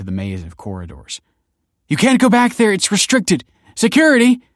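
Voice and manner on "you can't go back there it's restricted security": high, loud warning shout; alarmed calling out; urgent cry. A narrator's reading. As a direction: shout after them and call for help. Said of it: "cried Kelsey".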